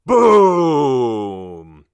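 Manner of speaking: loud, drawn-out falling boom; booming blast. A narrator's reading. Narrator voices the dynamite going off, booming. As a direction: let the word explode and sink away.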